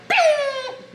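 very high, held "bing" noise; imitated ding. Mom showing how fast her children react, high imitated bing. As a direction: sing out a high-pitched ping.

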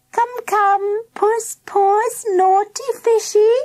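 very high sing-out cat call; high-pitched girl calling puss. A narrator's reading. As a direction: coax a pet from afar in a high voice. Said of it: "called Mandy".

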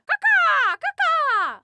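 loud high caw-caw crow imitation; squawking bird call.

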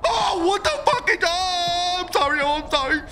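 high, loud frightened pleading with a crying edge; panicked pleading. Real, not acted. Player begs the attacking ghost to stop, panicked and near tears. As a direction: beg the ghost for mercy in panic.

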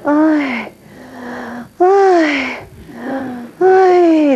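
climbing cries from behind the door; woman's cries. Retold or imitated. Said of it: "se escucha a la niña".

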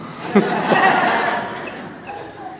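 breathy unvoiced laugh; airy laugh. Real, not acted. Preacher laughing at the wife's punchline, airy laugh.

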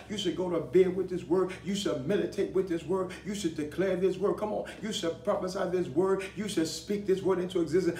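impassioned preacher in full flow; fervent preaching. Real, not acted. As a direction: exhort the congregation in punched, repeated phrases.

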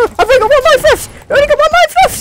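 high, loud desperate cry; panicked shout. Real, not acted. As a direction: scream it in desperation. Last life in game.

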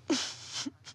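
short voiceless laugh; helpless laugh. Acted. Woman learning she attacked the wrong couple, helpless laugh. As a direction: laugh despite feeling like crying. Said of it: "I don't know why I'm laughing".

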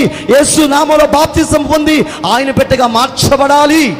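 high, loud, slow proclamation; fiery altar-call sermon; full-force preaching. Acted. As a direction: proclaim the gospel with all your strength.

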